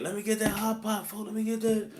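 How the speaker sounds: a hint of a crowd of eager beggars; begging inmates. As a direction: pester him like everyone grabbing for stuff.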